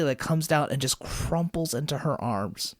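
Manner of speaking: ordinary talk, nothing marked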